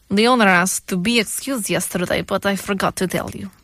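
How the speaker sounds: put-on deliberate, phrase-by-phrase Russian accent; mock Russian accent, evenly measured. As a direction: read it as a put-on Russian movie heavy. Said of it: "I'll do the Russian accent".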